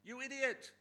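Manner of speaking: loud, high angry yell; retold shout. Husband shouting at the elder on the phone, a loud high yell. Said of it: "I've never heard you shout like that".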